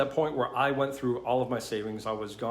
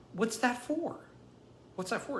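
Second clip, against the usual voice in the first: much higher, hushed astonished question; retold disbelief; 11 semitones higher, 5 dB quieter than usual. Retelling own reaction to money from a stranger, stunned. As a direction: ask it high and small, taken aback. Retold shock at the fifty dollar bill.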